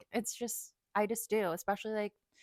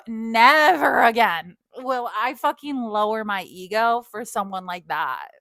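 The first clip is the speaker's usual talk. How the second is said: slow, loud, every word hit; emphatic vow; 10 dB louder, slower than usual. Real, not acted. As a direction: vow it slowly and loudly. Vowing lesson two.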